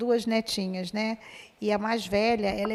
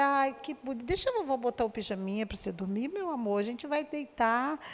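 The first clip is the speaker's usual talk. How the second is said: much softer, coaxing the granddaughter close; tender grandma; 5 dB quieter than usual. Retold or imitated. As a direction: hush her gently into a hug. (avó falando baixinho e carinhosa com a neta)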